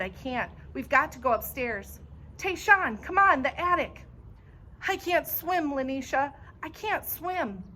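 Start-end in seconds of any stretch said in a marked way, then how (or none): 2.4-4.0 high, loud urgent call; frantic command. A narrator's reading. Urgent calling during flood. 4.9-7.6 high, scared plea; a boy too scared to move; frightened begging. A narrator's reading.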